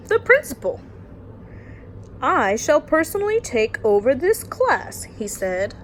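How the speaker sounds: lively storytime read-aloud; story narration. Acted. Teacher reading a bedtime picture book to her class, lively storytime voice.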